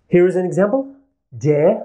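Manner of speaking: normal talking voice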